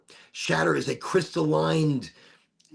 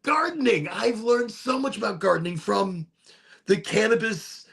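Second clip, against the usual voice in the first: much higher, enthusiastic gushing; excited wonder; 9 semitones higher than usual. Real, not acted. Marvels at what gardening taught them.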